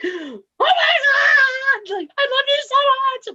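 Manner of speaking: high, loud, very slow mock sob; crying fan. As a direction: wail it like a fan in tears.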